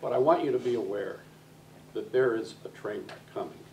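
normal talking voice

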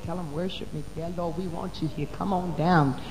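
soft prayer. Retold or imitated. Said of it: "something God said".

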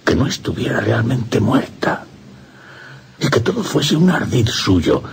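climbing high and breathless; feverish rising hope. Acted. Priest imagining she only feigns death, feverish. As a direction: let the wild hope lift the voice.

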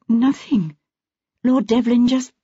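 put-on polite, slightly nervous young woman; Mrs Quayle's voice. A narrator's reading. Wife answers husband, startled.

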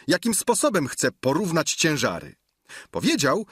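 ordinary delivery, nothing marked

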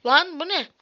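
startled loud outburst; surprised. Real, not acted. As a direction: caught off guard. Sudden surprise at a glitch.

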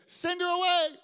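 sharp high loud dismissal; annoyed disciples. Acted. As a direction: shoo her off impatiently. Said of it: "Disciples say".

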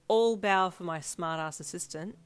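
slow, loud teasing comeback; playful retort. A narrator's reading. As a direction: tease him right back. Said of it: "you retorted playfully".

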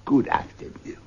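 clipped cold goodbye; curt dismissal. Acted. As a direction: send him away coldly. Scrooge dismisses nephew.

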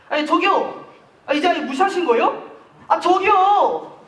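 rising annoyance at being ignored; exasperated calling. Acted. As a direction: demand their attention, increasingly fed up.

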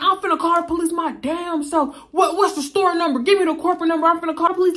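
high, mock-outraged threat; acting outraged. Customer threatens to call police on the manager, high mock outrage. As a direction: threaten like an indignant victim. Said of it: "acting so outraged".

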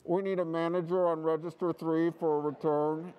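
droning cashier on the PA; store intercom announcement. Retold or imitated.

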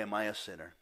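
usual speaking voice

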